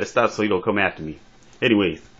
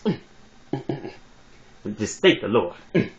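hammed-up preacher easing off after a wail; comic preacher; 4 dB quieter than usual. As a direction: hum between short pious phrases. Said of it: "talk like this brother being a preacher".

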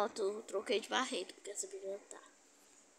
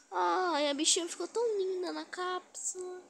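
gushing delight; 7 semitones higher, 5 dB louder than usual. Real, not acted. Gloating over the trapped girl, high cooing. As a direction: coo over her like a cute pet.

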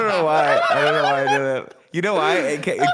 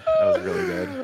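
mock high voice. Echoing Mike impression, praising.

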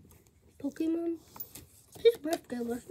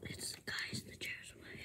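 whispering; 21 dB quieter than usual. Real, not acted. Hushed while sneaking in room.

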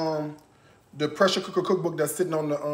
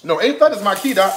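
insistent; 4 semitones higher, 6 dB louder, much faster than usual. Real, not acted. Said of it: "That was in A flat".

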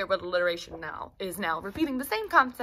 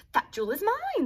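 high, eager declaration; eager claim; 10 semitones higher than usual. Acted. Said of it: "they each declared".

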